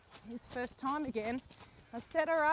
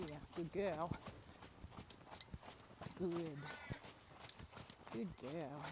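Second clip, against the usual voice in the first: low, soft praise to the horse; 8 semitones lower, 13 dB quieter, slower than usual. Real, not acted. Rider praising her mare, low and soft. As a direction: soothe the mare gently.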